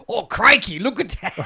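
put-on high, loud Australian accent; Australian accent, khaki-clad wildlife host; Australian accent, amazed at a croc; Crocodile Hunter impression. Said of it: "I'm trying to do the guy who died".

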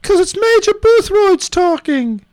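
high British character voice; Major Boothroyd. Acted. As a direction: put on a high-pitched British accent. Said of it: "I'm doing it in a British accent".

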